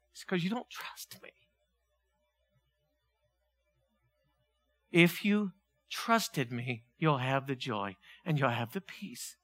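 hushed divine reply; quiet voice of God. Acted. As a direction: answer softly as God would. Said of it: "God's answer, quiet".

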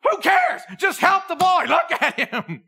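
a frantic parent snapping at the bystander; outraged parent. Pretend.

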